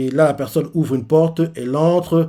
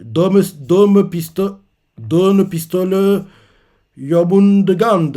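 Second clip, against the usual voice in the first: louder, slowed-down attack cry, syllables stretched; slow attack call; 5 semitones higher, 5 dB louder, much slower than usual. Retold or imitated. The sun god calling his attack, slow loud call. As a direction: call the attack out slowly and loudly.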